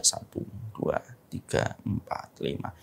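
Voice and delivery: soft breathy count to five under the breath; whispered counting. Shown as a demonstration. Preacher shows husband counting silently while wife rages, whispered. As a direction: count quietly to yourself, barely voiced. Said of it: "hitung aja".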